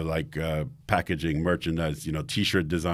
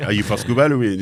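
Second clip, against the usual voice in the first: put-on French accent; 4 semitones higher, 6 dB louder, faster than usual. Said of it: "And that whole, like".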